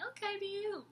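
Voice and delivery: high, soft, smiling inner voice; pleased little reaction. Retold or imitated. Woman sitting there smiling a little at her date, high and pleased. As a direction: lift it high and small, acting quietly pleased.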